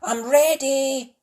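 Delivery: high, slow puppy voice; high little puppy calling out; Spot's eager voice. A narrator's reading. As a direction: voice Spot up high and bright. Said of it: "Spot shouted".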